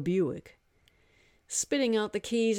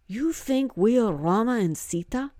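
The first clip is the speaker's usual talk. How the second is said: a hint of a stunned young man, a bit higher; incredulous young man, lightly raised; lightly voiced young man; 5 semitones higher, 5 dB louder than usual. Hari questioning the god, lightly voiced and incredulous.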